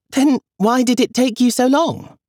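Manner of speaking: put-on very high, puzzled girl voice; child Julia. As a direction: ask like a baffled little girl. Child asks.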